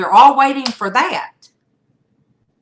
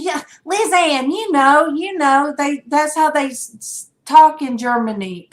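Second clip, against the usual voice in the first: retold own reply; 6 semitones higher than usual. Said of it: "And I said".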